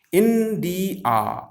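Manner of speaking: "India" in three beats, in-di-ya; syllable by syllable. Shown as a demonstration. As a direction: separate each syllable of "India". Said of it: "It's three syllabic words".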